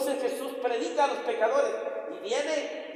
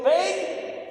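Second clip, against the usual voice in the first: commanding call; 7 semitones higher than usual. Acted. Centurion commanding his soldiers.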